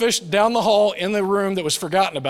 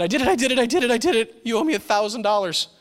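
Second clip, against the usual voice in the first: put-on higher, gleeful little girl's chant; lightly voiced daughter bursting with news; excited child; 6 semitones higher, faster than usual. Father retells daughter running in on Mother's Day, excited. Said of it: "came running down the stairs and said".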